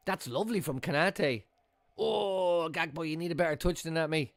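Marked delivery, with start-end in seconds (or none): none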